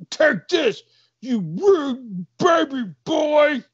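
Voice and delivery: high, slow, loud mock shout; hammed-up tearful battle cry; attacking guard. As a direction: yell it as you swing. Guard attacks with his baton.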